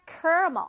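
caramel as two beats, KAR-mul; alternative pronunciation. Shown as a demonstration. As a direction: squeeze caramel down to car-mul with an r. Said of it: "어떤 사람들은 caramel라고 합니다".

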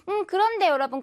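normal talking voice

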